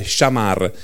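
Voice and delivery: shamar with clear final r; Hebrew word. Shown as a demonstration. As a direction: say shamar slowly, final r rolled. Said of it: "con l'H".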